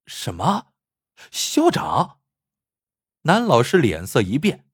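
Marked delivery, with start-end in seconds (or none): 0.1-2.2 surprised. A narrator's reading. Man hears principal invited him.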